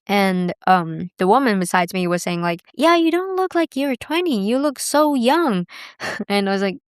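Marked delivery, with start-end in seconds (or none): 2.7-5.7 high insistent. Retold or imitated.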